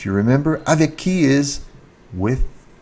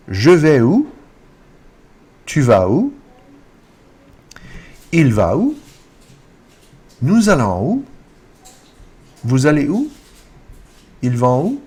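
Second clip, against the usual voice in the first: loud model questions, each "où" swept up; "où" climbing high every time; rising intonation; 4 semitones higher, 5 dB louder than usual. Shown as a demonstration. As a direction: drill each question with a lift on "où". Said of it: "so here's how it looks".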